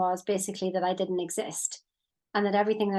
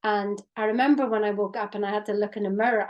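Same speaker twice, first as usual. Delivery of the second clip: ordinary talk, nothing marked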